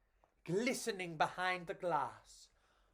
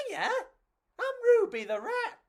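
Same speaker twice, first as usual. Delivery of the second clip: high, louder bubbly rat voice; friendly rat; 6 dB louder than usual. Acted. Ruby the rat greeting the two roaches. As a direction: greet them like a cheery rat. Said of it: "the pear-shaped figure said".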